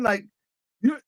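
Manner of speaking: usual speaking voice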